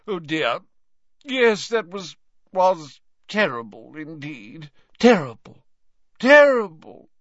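high tipsy lament, growing loud; flustered old professor. A narrator's reading.

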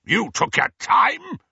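loud, high angry roar; furious uncle roaring. A narrator's reading. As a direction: bark it at the boy. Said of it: "roared Vernon Dursley".

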